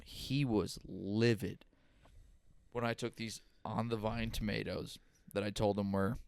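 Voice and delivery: ordinary talk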